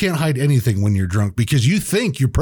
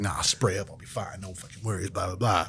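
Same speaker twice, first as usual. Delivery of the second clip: soft, lower mumbled self-talk; muttered to self; 5 semitones lower, 12 dB quieter than usual. Retold or imitated. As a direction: mutter it under your breath. Mocking his teenage self-talk.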